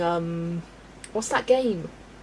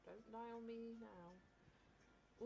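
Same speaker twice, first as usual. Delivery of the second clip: ordinary talk, nothing marked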